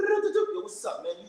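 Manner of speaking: yo jumps up high; excited greeting. Retold or imitated. Greeting God like a buddy, excited yo. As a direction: hype greeting to an old friend.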